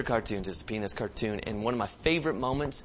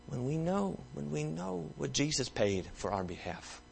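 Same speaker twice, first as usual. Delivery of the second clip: hushed sermon; 6 dB quieter than usual. Acted. Pastor reflecting on communion with the church, hushed and tender. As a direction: bring them down to a near hush.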